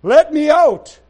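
loud cry, growing on the second; the Lord calling. Acted. Preacher voicing the Lord inside, building cry. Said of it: "I hear the Lord saying".

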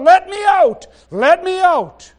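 high loud cry, second one slowed and dragged. Acted. Preacher voicing the Spirit crying within believers, high shout. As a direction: shout it up high, then draw it out slow. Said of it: "He's crying out".

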